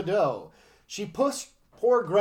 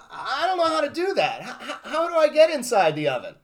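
playing dumb; 6 semitones higher than usual. A narrator's reading. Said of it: "So she said".

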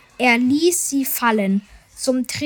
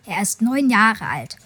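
ordinary delivery

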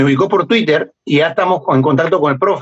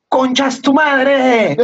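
slow, higher mock insult with foreign accent; imitating the guy's insult; 8 semitones higher, much slower than usual. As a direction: drawl the insult like a foreigner learning Spanish. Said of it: "él me dice".

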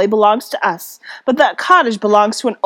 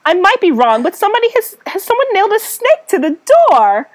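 put-on high, bouncy Valley Girl voice, pitched up; Valley Girl accent; 7 semitones higher, faster than usual. Cecilia gasping at the snake on the door, Valley Girl accent. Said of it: "Valley Girl".

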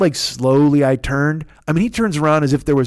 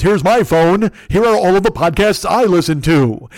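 loud, raised mock-grand voice; Fearful; 3 semitones higher, 4 dB louder than usual. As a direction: announce it like a proclamation from the mountain. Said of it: "I'm trying to do his, his, uh, on the mountain voice".

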